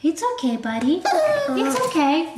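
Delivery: high, drawn-out it's okay; calm the crying puppy down; soothing coo. Real, not acted. Comforting the whimpering puppy, slow high coo.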